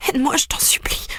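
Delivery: high, breathless whispered begging; whispered plea. A narrator's reading. Beaten sister whispers to her brother for help. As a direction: beg for help with no voice left.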